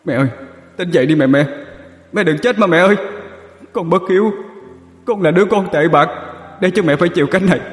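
put-on high sobbing wail of a ghost boy; wailing son. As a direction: beg her to wake up, voice breaking. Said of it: "Nó gào lên gọi bà".

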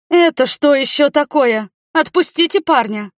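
high, loud outraged protest; indignant. A narrator's reading. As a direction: demand his release, scandalised. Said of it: "возмутилась мама".

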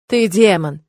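usual speaking voice

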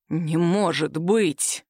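very slow, low, stunned delivery; long-drawn low exclamation of shock; drawn-out disbelief. A narrator's reading. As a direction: stretch every word out in disbelief. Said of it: "протянул хриплым голосом".

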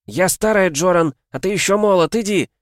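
high, loud pleading order; insistent high-pitched yell; urgent shouting. A narrator's reading. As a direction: argue hard over the wind. Shouted argument in the storm.

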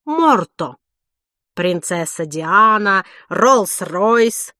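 chef voice. A narrator's reading. Chef guessing famous names.

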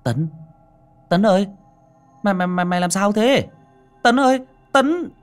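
high, loud, hurried calls to wake him; urgent calling. Acted. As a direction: shout his name, shaking him awake. Friend shakes him awake calling. (gọi to hốt hoảng)

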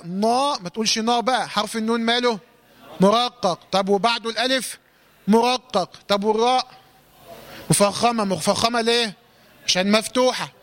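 ordinary talk

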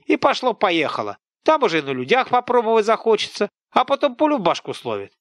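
high, loud village scold; an angry peasant woman tearing into a boy; scolding old woman. Acted. Woman lectures in story. (сварливая тётка, громко и высоко)

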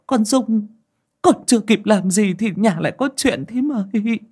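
trembling fear. A narrator's reading. Bà Nối stammering to her aunt about the curse, frightened. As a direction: stumble over the words, scared stiff. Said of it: "Bà Nối run rẩy".

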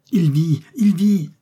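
high, loud cry of relief; elated outcry; exclaiming. A narrator's reading. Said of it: "s'écria Fergusson".

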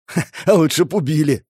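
bitter little "ha" up front; scoffing. A narrator's reading. Doomed man answers hope of mercy, scoffing. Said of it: "хмыкнул Игорь".

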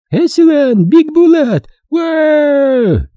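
very high wailing cry; истошный вопль. A narrator's reading. Перепуганная женщина вопит, увидев гранату. (высокий панический крик)

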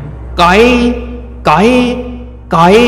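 a high, strange cry drawing nearer; eerie creature call. Acted. Narrator voices the creature calling nearer. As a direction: call like something coming closer in the dark. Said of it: "สักพักเสียง".

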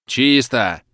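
a shout from the far rooms; distant shout. Acted. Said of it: "кричат в глубине квартиры".

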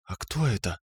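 soft, hushed question; whispered. A narrator's reading. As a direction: ask it under your breath. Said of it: "шепнув".

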